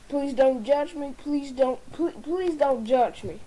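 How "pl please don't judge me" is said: ordinary talk, nothing marked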